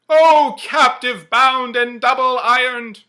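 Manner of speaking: high, loud, slow ghostly cry. Acted. The phantom cries out to Scrooge, high and loud. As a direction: cry out in anguish. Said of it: "cried the phantom".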